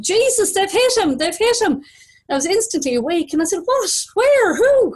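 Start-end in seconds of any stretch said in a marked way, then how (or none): 0.0-1.7 high-pitched mock scream, short of full volume; retold scream. Driver screams to dozing passenger about a crash, retold scream. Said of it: "John breaking hard and screaming". 3.6-4.9 very high, loud one-word questions; startled alarm. Retold or imitated. Passenger woken by screaming driver, startled alarm.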